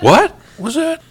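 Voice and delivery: high, loud startled cry; startled guard. Acted. Game master plays a guard hearing a voice, startled. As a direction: call it out high and drawn out, acting startled.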